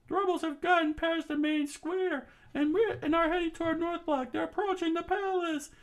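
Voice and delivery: high urgent soldier report, far off; soldier on radio. Acted. Guard reporting to headquarters, high and urgent. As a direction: report losses in a frantic high voice.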